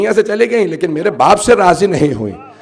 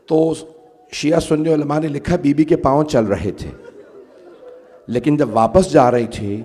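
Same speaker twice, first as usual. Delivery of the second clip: low lament with long pauses; mournful sermon narration; 5 semitones lower, 6 dB quieter, slower than usual. Acted. Preacher tells mourners of Bibi leaving the court, in low lament. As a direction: recount the grief low and haltingly.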